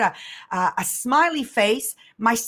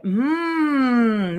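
thoughtful hum; 3 semitones higher than usual. Retold or imitated. Mother considering son's idea.

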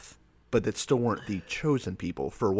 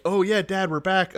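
higher-pitched, offhand report to Dad; casual, pitched-up daughter voice; imitated daughters; 7 semitones higher than usual. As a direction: lightly voice the kids coming home.

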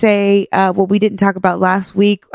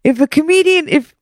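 pitched-up joke-telling dad; imitating dad; 9 semitones higher than usual. Dad telling cousin Josephine a joke, imitated high voice. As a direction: address her as a dad launching a joke, high. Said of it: "He was like".

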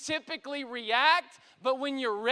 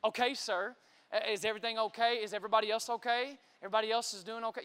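lower, quick, even dispatcher voice; composed responder; 4 semitones lower, much faster than usual. Retold or imitated. Dispatcher checking on a panicking caller, composed. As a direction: imitate an unruffled emergency operator. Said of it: "they are responding rather than reacting".

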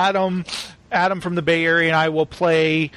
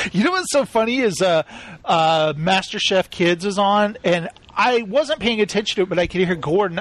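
normal talking voice

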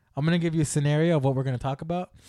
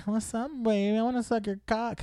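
flamboyant flirt voice; 7 semitones higher, 4 dB quieter than usual. Retold or imitated. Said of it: "you walk around".

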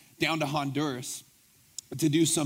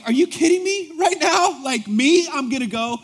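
much higher, loud incredulous cry; stunned disbelief; 10 semitones higher, 7 dB louder than usual. Retold or imitated. Pastor reacting to news of the prison, stunned and loud. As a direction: let the shock burst out. Learning he must preach in prison.

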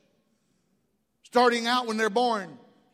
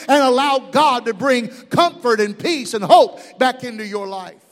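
loud rising preacher climax; preaching at full force; 9 dB louder than usual. Real, not acted. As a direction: build to a roaring sermon peak. Sermon climax.

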